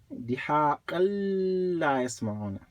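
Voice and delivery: "دِهَاقًا" glides into a prolonged L of "لَا"; emphasized shadda. Shown as a demonstration. Teacher repeating so you can see, long joined L on "لَا". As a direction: dwell on the doubled L starting "لَا", no break. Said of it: "I emphasize it more so you can see".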